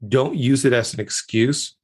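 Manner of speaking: ordinary delivery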